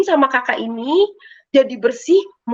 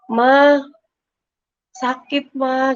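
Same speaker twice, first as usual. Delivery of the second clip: pained moaning; 2 semitones higher, much slower than usual. Retold or imitated. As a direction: lightly imitate moaning in pain, with pauses. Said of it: "Ngerintih sakit, merintih gitu kan". (rintihan kesakitan yang ditirukan)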